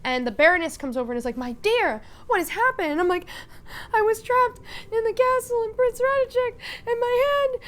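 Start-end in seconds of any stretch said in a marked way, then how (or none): 1.4-2.9 high, loud, swooping distress; alarmed concern. Acted. Baroness coming over to injured Odette, alarmed. 3.9-7.6 very high, loud hysterical wailing. Acted. Odette telling the Baroness about the castle, hysterical. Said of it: "And she's just hysterical".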